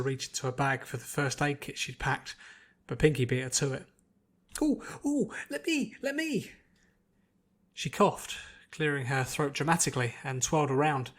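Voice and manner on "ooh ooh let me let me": very high, slow pleading squeal; eager squeal. A narrator's reading. Pinkie begging to heal Applejack, eager. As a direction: bounce with eagerness.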